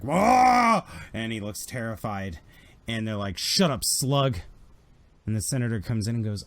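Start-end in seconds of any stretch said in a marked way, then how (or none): 0.0-0.8 high, loud cry of alarm; terrified yell. Acted. Game master voices a Hutt as commandos burst in, terrified yell. 3.4-4.4 louder, slow and forceful command; harsh order. Acted. Imperial commandos to a yelling Hutt, harsh order.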